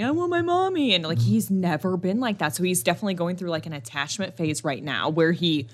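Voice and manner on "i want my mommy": put-on higher toddler whine; imitating crying toddler. Mom voicing her son crying for her, higher. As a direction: whimper it like a little boy. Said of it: "was crying".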